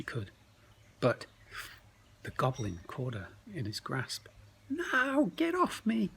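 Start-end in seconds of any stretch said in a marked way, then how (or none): 4.7-6.1 very high panicked squeal; frightened cry. Acted. Crinklebutt caught in the goblin's grasp, high frightened squeal. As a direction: struggle free, squealing in fright.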